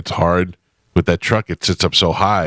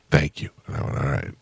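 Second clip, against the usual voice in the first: low, quiet grudging acceptance; soft, low retold grumble; grudging aside; 4 semitones lower, 6 dB quieter than usual. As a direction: drop it to an under-the-breath retort. Retold grudging end to car quarrel.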